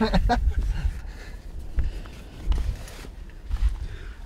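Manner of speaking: breathy trailing laughter; long laugh. Real, not acted. Laughing at joke.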